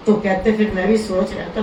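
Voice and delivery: normal talking voice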